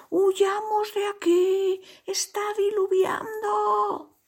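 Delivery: high, drawn-out panicked cries; alarmed shouting. A narrator's reading. Animals fleeing the flooded jungle, shouting. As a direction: wail the warning in a high put-on voice. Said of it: "gritaban todos".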